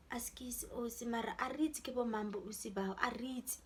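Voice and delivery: soft, near-whispered apology; hushed grandmothers apologising. Retold or imitated. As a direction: quietly say sorry, they don't know them.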